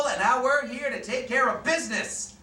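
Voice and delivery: normal talking voice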